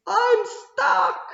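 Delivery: high, loud whiny fox; complaining little fox. A narrator's reading. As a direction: whine that you're stuck. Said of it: "this little fox is complaining".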